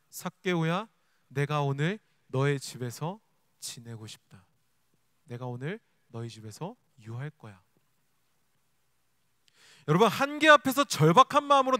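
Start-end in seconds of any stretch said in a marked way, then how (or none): 0.1-7.6 hushed, low, unhurried tenderness; gentle Jesus. Acted. Jesus telling Zacchaeus he will stay at his house, tenderly. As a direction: call softly, then quietly promise to stay. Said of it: "말씀하십니다".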